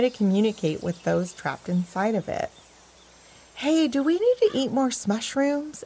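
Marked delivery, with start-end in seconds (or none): 3.5-5.8 high, eager question; bright-eyed eager pup. A narrator's reading. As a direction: ask keenly, voice pitched up. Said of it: "asked Power Dog eagerly".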